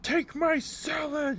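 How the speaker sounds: high, slow, yelled take-it line; hammed-up retold yell; shouted demand. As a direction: bellow it way up high and drawn out. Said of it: "our wizard player goes".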